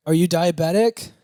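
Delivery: high, loud clerk question; booking clerk. Retold or imitated. Counter lady asking Bob if diabetic, high voice.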